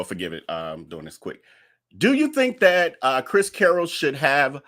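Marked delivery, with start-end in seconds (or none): 0.0-1.4 low, soft throwaway apology; offhand aside. Real, not acted. Host excuses possible typos in the poll, low muttered aside. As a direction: let it drop away under your breath.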